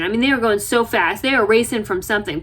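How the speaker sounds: ordinary talk, nothing marked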